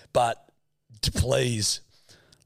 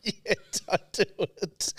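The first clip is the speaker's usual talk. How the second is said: laughing; 10 semitones higher than usual. Real, not acted. Laughing after the shriek.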